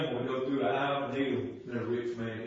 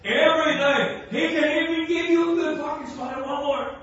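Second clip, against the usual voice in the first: loud, higher, pounding preaching; full-force preaching; 13 semitones higher, 11 dB louder than usual. Acted.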